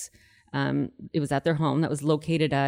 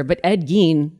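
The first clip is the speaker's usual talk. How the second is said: ordinary delivery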